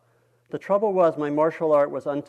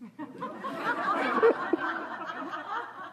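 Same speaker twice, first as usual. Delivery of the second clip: real laugh; 5 dB quieter than usual. Real, not acted. Reader laughs at the story.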